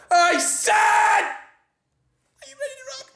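overexcited stage shout, slow on the last line; hyped rock shout. Retold or imitated. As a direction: shout it like a rock frontman to a dead room. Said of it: "you walk on just being like".

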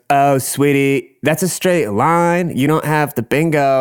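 high, slow mock-pitying hostess; drag queen voice. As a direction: draw it out condescendingly as drag host. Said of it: "the fucking Carl Panzram".

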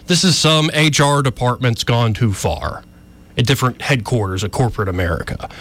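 ordinary talk, nothing marked

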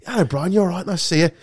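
mock northern Yorkshire hello; northern accent. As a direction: put on Yorkshire to charm the boss. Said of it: "So I just suddenly went northern".

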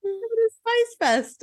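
slow, high, savoring each word; drawn-out high. Acted. Teller repeats the punchline to friend, slow and high. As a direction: stretch it slow and high-pitched.

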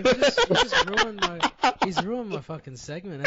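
high, cracking up while protesting; amused outrage breaking into laughs; laughing complaint. Real, not acted. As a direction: complain through helpless laughter. Mock outrage at spoiled segment.

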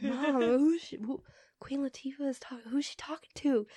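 urgent hushed whisper; whispering to her mom. Retold or imitated. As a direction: whisper it, starstruck. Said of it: "I'm like".

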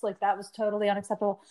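ordinary talk, nothing marked

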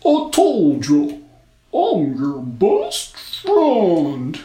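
loud, very slow snowman; insistent snowman. A narrator's reading.

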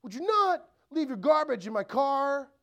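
high-pitched, irritated scolding; spiteful. Acted. Spouse complaining about garbage in the car, spiteful. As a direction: pitch it up and make the request a jab. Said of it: "say it in a spiteful way".

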